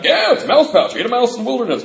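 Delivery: high, loud boasting; excited bragging. Retold or imitated. Newcomer telling everybody about his pouch, mock excited bragging. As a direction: blurt it out like a proud kid. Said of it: "I'm telling everybody".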